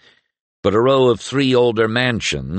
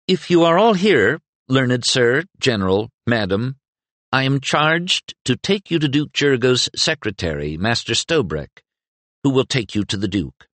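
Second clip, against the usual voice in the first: put-on nervous page boy; 6 semitones higher than usual. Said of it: "a nervous youth of perhaps twelve".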